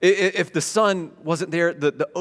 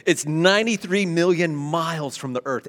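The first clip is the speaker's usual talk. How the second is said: normal talking voice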